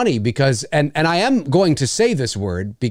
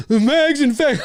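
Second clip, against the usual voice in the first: high laughing shout of the name; kids' mocking laugh; 11 semitones higher, 3 dB louder, slower than usual. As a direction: shout it like giggling ten-year-olds. Kids mocking the store name.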